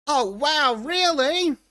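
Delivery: a sneering, squeaky really that rises to the top; sarcastic disbelief. Acted. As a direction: pretend to be wowed by something dull. Mocking a quoted article.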